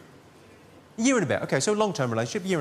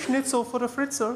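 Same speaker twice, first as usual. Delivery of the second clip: mock German; 5 semitones higher than usual.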